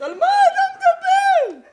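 very high, slow laughing squeal; mock falsetto at a foolish boy; squeaky mock disbelief. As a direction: stretch it out in a ridiculing falsetto. Mocking disbelief.

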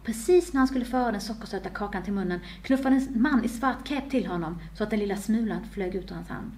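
ordinary delivery

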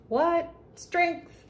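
high surprised yelp at the card. Real, not acted. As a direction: pitch jumps up in real surprise.

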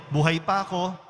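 normal talking voice

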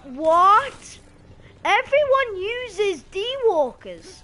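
loud, high, drawn-out protest; outraged shout. Real, not acted. Gamer complaining about what everyone uses, outraged slow shout. Outraged at other players' weapon.

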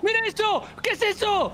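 high-pitched excited outburst; real gamer astonishment; amazed exclamation. Real, not acted. Amazed by Marbella in game.

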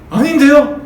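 loud denial. Acted. Peter loudly denying it to the servant girl, sharp and insistent. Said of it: "베드로가 뭐라고 했게 해요?".

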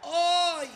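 long, high "ay"; anxious wail. Real, not acted. Player after a scare in the match, anxious wail. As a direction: let out a high drawn-out wail.

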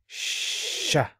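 sha said high and drawn out, ʃ then open a; demo sha. Shown as a demonstration. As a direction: stretch out sha with a long ʃ. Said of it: "it gives the sound sha".

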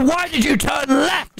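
high, slow exasperated yell; yelling at the driver who turned left; mock angry shout. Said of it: "And I go".

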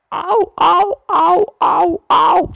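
high, loud squealing cries of toy trucks; screaming trucks. A narrator's reading. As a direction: shriek it high and loud like little trucks in pain. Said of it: "screamed the trucks".

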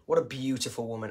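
normal talking voice

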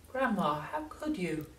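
put-on high, revolted boy; a young boy recoiling; disgusted boy voice. A narrator's reading.

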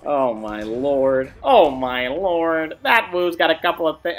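ordinary talk

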